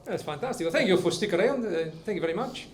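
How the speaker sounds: put-on comic Italian accent, easygoing; Italian character accent. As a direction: stay in the Italian character while chatting. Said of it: "I'll stay in character".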